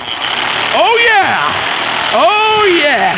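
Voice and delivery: high, loud hammed-up whoops of joy; over-the-top excited cheering; mock excited. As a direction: whoop like a fan who just found the power. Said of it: "I'm all excited".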